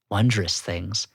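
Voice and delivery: low, slow, hushed awe; mock reverent. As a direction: savor each treasure with mock wonder. Mock-grand pitch of member perks.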